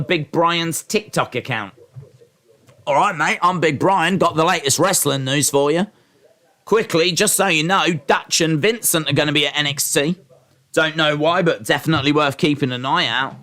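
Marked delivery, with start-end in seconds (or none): 2.9-13.4 lightly put-on Australian news guy; a hint of an Aussie tipster; mock Australian Big Brian. Big Brian giving chat wrestling news, mock Australian. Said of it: "I don't know why Big Brian is, uh, Australian".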